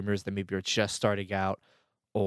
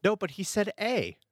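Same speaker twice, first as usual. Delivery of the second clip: excited; 11 semitones higher than usual. Retold or imitated. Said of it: "That's the trademark Canadian word".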